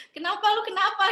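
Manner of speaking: mom pressing, pitched high and loud; nagging mom, high and loud; pushy mom. Retold or imitated. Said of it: "Dia yang kayak". (ibu mendesak, suara tinggi dan keras)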